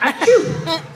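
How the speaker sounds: high hammed-up sneeze; mock sneeze. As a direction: fake a big sneeze. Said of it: "Groucho starts to sneeze".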